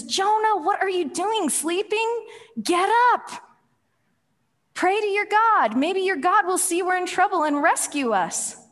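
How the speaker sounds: high, loud urgent rousing; acted-out frantic captain's yell; alarmed captain. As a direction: shout a sleeper awake in a crisis. Captain rousing sleeping Jonah in storm.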